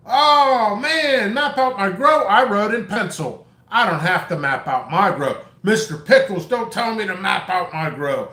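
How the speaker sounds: mocking whiny customer. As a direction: ham up an indignant customer who refuses advice. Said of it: "Do you know people argue with me every year?".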